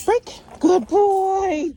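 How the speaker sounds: high, loud puppy praise; high excited praise. Real, not acted. Trainer praising puppy, high and loud.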